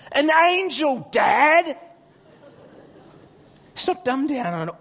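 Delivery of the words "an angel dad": loud, high, stretched answer; exclaiming child. Retold or imitated. Son explaining the escape to dad, loud and high. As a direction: tell Dad as if it's obvious.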